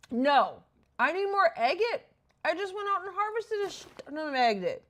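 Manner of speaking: loud, high-pitched disbelief; exasperated protest. Real, not acted. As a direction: protest it loudly, pitch jumping up. Out of agate just after harvesting.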